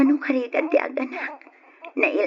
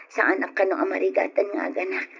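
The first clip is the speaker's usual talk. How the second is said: usual speaking voice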